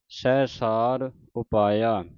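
drawn-out devotional reading, line by line; slow scripture recitation. Shown as a demonstration. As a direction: recite it slowly like Gurbani santhiya. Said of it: "ਸਾਜ ਤੇ ਵਿਸਰਾਮ ਲਾਉਣਾ ਹੈ". (ਹੌਲੀ ਹੌਲੀ ਪਾਠ ਦਾ ਉਚਾਰਨ)

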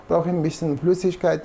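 ordinary delivery

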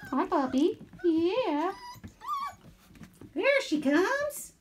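high slow cooing. Real, not acted. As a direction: coo at puppies. Greeting puppies.

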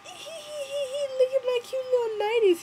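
put-on high, loud, giggly girly voice; mocking Bella voice.

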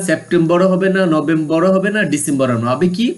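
ordinary talk

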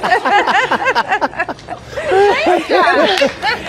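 long, high laughing fit; real extended giggling fit; helpless laughter. Real, not acted. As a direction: laugh at a clumsy landing. Laughing at failed landing.

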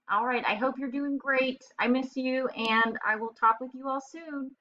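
ordinary delivery

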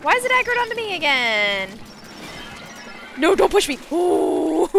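loud panicked cries; real startled panic. Real, not acted. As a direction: shout in alarm while dodging. Panicking near the water's edge.

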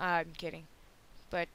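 ordinary talk, nothing marked

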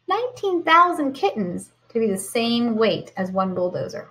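ordinary talk

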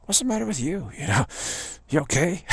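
imitating baffled friends, high and quizzical; puzzled onlookers. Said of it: "look at me like".

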